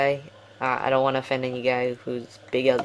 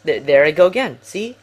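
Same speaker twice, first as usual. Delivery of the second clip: sudden loud outburst; 8 dB louder than usual. Real, not acted. Talking to viewers about his tired commentaries, suddenly loud. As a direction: burst out loud, then drop to a quick question. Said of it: "I'm being silent again".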